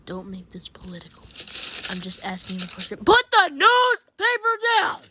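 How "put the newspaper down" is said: loud, high, slow shouted command; mock order. Ordering Ben to drop the newspaper, loud slow shout. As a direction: bellow it like a fed-up parent.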